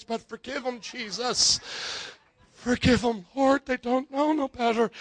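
hushed, halting plea to God; acted pained prayer, fading and swelling; struggling prayer. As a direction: pray through clenched patience, with long pauses. Acts struggling prayer at home.

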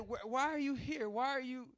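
ordinary delivery

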